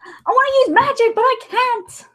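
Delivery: high, whiny complaint; frustrated unicorn whine. Retold or imitated. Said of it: "every unicorn ever".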